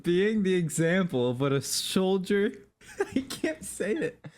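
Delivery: giggling through the words; trying to talk while laughing. Real, not acted. Cracking up mid-line.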